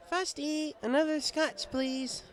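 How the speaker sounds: high, loud call for another drink; high tipsy mare calling the bartender; tipsy Twilight voice. Acted. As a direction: call out for a refill, half drunk. Drunk Twilight calls to bartender.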